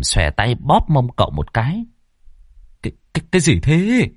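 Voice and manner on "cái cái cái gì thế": startled stammer. A narrator's reading. Startled by pinch.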